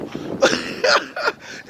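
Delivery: breathy high giggle; high airy laugh. Real, not acted. Laughing at his own joke about thirsty men.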